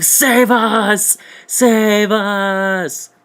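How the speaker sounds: high, loud shrieked plea; mock plea for rescue. Jumping into David's arms, high shrieked plea for rescue. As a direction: wail for help from David's arms. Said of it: "I'm like".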